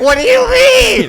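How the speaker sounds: very high, drawn-out screech; mock outraged yell. Host jokes when Americans are called loud, mock screaming. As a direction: shriek it in fake disbelief.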